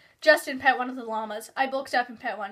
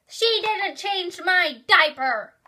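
put-on tattling toddler; 7 semitones higher, 8 dB louder, much slower than usual.

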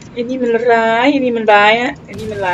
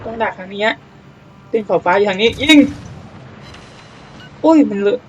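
usual speaking voice

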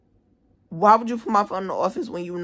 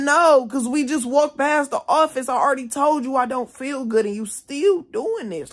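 high, loud exasperated protest; angry outburst, retold; 7 semitones higher, 4 dB louder than usual. Student refusing to calm down for staffer, retold loud protest. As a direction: fire back high and raised, fed up.

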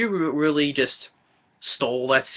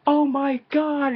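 high, drawn-out mock yell at normal volume; hammed-up, stretched-out high cry; over-the-top yell; 13 semitones higher, much slower than usual. Reviewer imitating an actor riding with his co-star, over-the-top yelling. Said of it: "when he's yelling".

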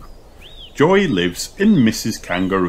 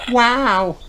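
high-pitched wow; amazed gasp. A narrator's reading. Kylie watches Peppa splash, amazed. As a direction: breathe out wonder, pitched far up. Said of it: "gasped Kylie".

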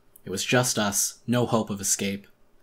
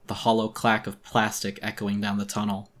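ordinary delivery

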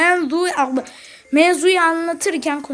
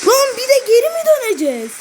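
exasperated outburst; 8 semitones higher, 3 dB louder, faster than usual. Real, not acted. Gamer annoyed at going back.

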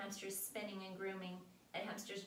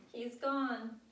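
moaning; 5 semitones higher, 8 dB louder than usual. A narrator's reading. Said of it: "Sam moaned".

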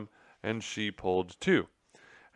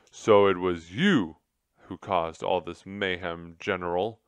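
loud scolding accusation; furious; 8 dB louder than usual. A narrator's reading. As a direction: scold him, fuming. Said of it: "Tum Tum said furiously".

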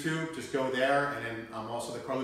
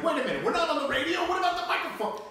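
much higher, fast panicky mock voice of his guest; mock freaking out; 14 semitones higher, 4 dB louder than usual. Host mocking guest's panic over the missing microphone, high and fast. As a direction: squeal it like a flustered guest. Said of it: "So Mike's freaking out because he's like".

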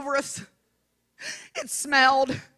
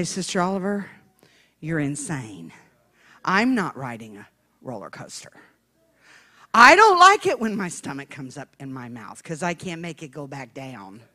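deadpan mock refusal; 10 semitones lower than usual. Rider-shy churchgoer tells Sister Oliver no, low deadpan refusal. Voicing those who refuse rides.

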